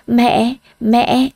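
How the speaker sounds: lightly voiced small child calling out; a little boy reaching for his mother; a toddler calling out for his mom. A narrator's reading. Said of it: "gọi mẹ".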